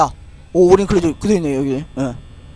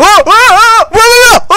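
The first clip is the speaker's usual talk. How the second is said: sudden high frightened yelp; startled scream; 10 dB louder, slower than usual. Real, not acted. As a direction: shriek at the jump scare. Jump scare in horror game.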